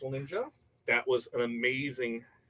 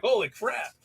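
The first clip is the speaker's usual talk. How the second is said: a higher, stunned exclaim; mock shock; 8 semitones higher, 3 dB louder than usual. Reacting to the fan's rant, higher mock shock. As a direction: gasp it out in disbelief.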